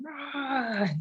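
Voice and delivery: high mock Hulk roar; playful pretend Hulk growl. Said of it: "Who doesn't love the Hulk".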